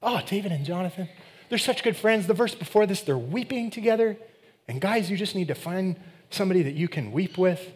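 high, gushing mock sweetness; mock sentimental. Preacher mocking a sentimental reading of the friendship, high gushing. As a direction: coo it like a sappy fan. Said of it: "easy to make this about, like, a sentimental relationship".

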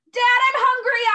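high, loud whining plea; whiny child. Acted. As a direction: whine for ice cream like a child. Child begging for ice cream.

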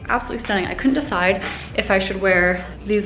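usual speaking voice

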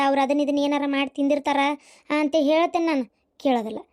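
ordinary talk, nothing marked

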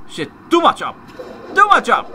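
high, loud exasperated shout; frustrated yell. Real, not acted. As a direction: yell at a botched aim. Missed the aim in game.

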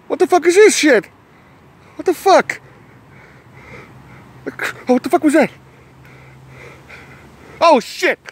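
high, loud frightened outburst; acted panic. Lost on the path and hearing noises, high loud panic. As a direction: squeal it in mock terror.

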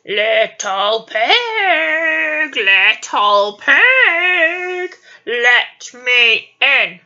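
slow, drawn-out wolf call. Acted. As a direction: growl it slowly like a wolf. Wolf voice in story.

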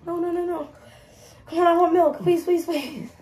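high, pleading through a burning mouth; mouth burning from spicy food. Real, not acted. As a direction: beg for milk, mouth on fire. Begging for milk after Reaper.

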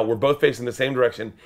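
usual speaking voice

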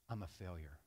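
low, soft, defeated admission; dejected. Retold or imitated. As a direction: quietly retell a son's despair. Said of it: "he had an emotional breakdown".

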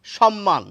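সম্মান said as "shom-man"; double-m pronunciation. Shown as a demonstration. Teacher showing what many say, সম্মান as "shom-man" with sh. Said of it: "আবার পরে অনেককে বলতে শুনি".

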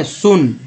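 सुन with an "oo" vowel, like "soon"; double O as oo. Shown as a demonstration. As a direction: say सुन with rounded oo. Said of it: "उ पढ़ रहे हैं ना". (सुन में double ओ का उच्चारण ऊ)

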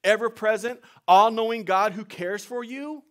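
loud, high, ringing sermon voice; full-force preaching. Acted. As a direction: preach it out to the whole church.

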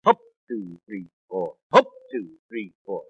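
a drill sergeant counting cadence in four. Shown as a demonstration. Imitating the sergeant counting marchers in four. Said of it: "the sergeant still counts out in four".

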